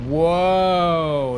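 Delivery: loud long awed whoa; amazed whoa. Real, not acted. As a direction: marvel out loud. Amazed at view.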